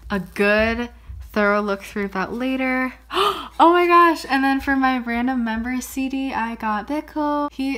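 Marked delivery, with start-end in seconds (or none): 3.0-3.5 high, quick gasp of delight; sudden high intake of breath; surprised gasp. Real, not acted. Discovers her random member CD. 3.6-4.3 high, loud burst of excitement; loud, high squeal of joy; thrilled exclamation. Real, not acted. Discovers her random member CD.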